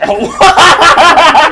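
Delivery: loud burst of laughter. Real, not acted. Laughing at the plot twist.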